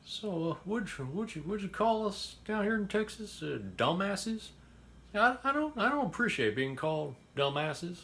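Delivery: high-pitched parody of an offended Texan; mocking Texan commenter. Host retelling a Texan viewer's angry video response, high mock offense. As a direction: squeak it out like a miffed Texan with a shotgun. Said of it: "It's like saying".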